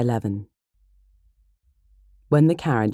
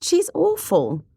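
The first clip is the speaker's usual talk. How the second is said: drawn-out, high-pitched exclamation; high, slow, indignant verdict; emphatic cry; 3 dB louder, slower than usual. Acted. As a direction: cry it out slowly in disgust. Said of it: "cried Kitty".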